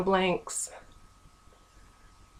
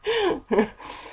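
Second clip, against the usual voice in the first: nervous giggle; 10 semitones higher than usual. Real, not acted. Nervous laugh before story.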